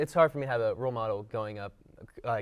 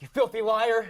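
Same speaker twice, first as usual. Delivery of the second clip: much higher and louder, a retold furious yell; screamed accusation; 10 semitones higher, 6 dB louder than usual. Singing along to a rapper's song, screaming the word liar. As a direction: scream it at the song like it's personal. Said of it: "I screamed it out".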